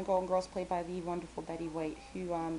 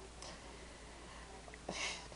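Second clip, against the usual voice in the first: soft drawn-out breath out; quiet frustrated sigh; 12 dB quieter than usual. Real, not acted. Frustrated by people who talk like papers, quiet sigh. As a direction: let out a tired sigh.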